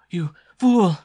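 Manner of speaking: high, very loud furious cry; shrieking. Acted. Captive girl struggling against her captor, shrieking. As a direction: hurl it out at the top of the voice. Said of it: "shrieked Constance".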